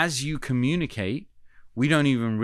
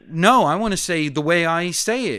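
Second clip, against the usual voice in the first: stubborn inner voice; 6 semitones higher, 8 dB louder than usual. Retold or imitated. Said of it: "my brain says".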